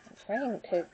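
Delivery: ordinary talk, nothing marked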